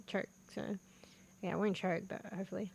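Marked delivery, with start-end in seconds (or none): none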